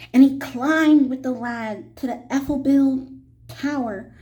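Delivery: plain reading voice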